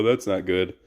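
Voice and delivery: ordinary delivery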